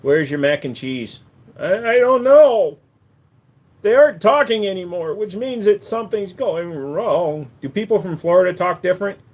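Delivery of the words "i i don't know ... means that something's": silly high character voice. Acted. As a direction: stretch out a squeaky I don't know. High slow put-on voice.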